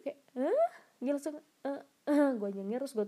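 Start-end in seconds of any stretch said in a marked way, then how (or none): 0.4-0.7 sharp high startled hah; a jolt of disbelief, pitched way up and loud; shocked hah. Retold or imitated. Recalling her reaction when the stranger on the bus asked her to travel together, startled.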